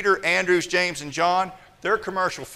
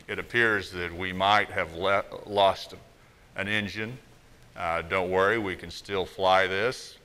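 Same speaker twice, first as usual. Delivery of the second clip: a relaxed Texas pilot calming everyone; laid-back Texan pilot; 6 semitones lower than usual. Retold or imitated. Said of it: "some guy who sounds like he's just drank off a sweet iced tea in the middle of Texas".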